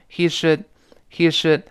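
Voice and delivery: "he should" with "should" reduced to "shd"; light "should", final d held back; unstressed, swallowed "should" after "he"; weak form. Shown as a demonstration. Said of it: "这是轻读".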